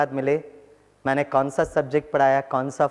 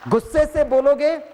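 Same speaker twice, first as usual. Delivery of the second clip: गुस्से raised high and loud; mock angry; 9 semitones higher, 4 dB louder than usual. Doctor acting out angry speech. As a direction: flare up playfully. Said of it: "मुस्कुराते हुए कहा".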